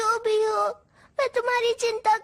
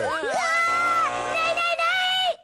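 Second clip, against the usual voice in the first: high, long yell then pleading; panicked cry; 7 semitones higher, much slower than usual. Acted. Robot punished by his programme begs it to stop, panicked high cry. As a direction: scream the first word out long, then beg.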